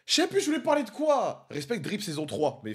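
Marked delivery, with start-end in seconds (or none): 0.1-1.5 high-pitched exasperated cry, falling at the end; flustered outburst. Real, not acted. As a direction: blurt it out high, having lost your thread. Perd soudain le fil en parlant. (exclamation aiguë et déboussolée)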